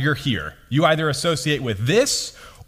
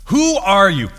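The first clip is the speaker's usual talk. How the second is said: higher and louder, pressing; demanding question; 5 semitones higher, 7 dB louder than usual. Retold or imitated. Priests demanding John's identity, loud and pressing. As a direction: challenge him, insistent.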